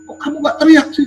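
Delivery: high, incredulous question; mock surprised wife. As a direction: play the wife amazed he didn't scream. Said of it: "Dia nanya". (istri heran, suara tinggi dan kaget)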